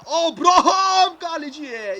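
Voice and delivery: high, loud, slow build-up call; rising excitement. Real, not acted. Calling the striker through on goal, excited build-up. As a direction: hold the name as he runs at the keeper. One on one with keeper.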